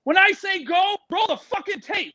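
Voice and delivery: high, emphatic movie-star command; Vince Vaughn giving orders. Retold or imitated. Actor orders the snake handler about the tape, high insistent command. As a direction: imitate an actor taking charge, pitch pushed up. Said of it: "So Vince looks at me, and he goes".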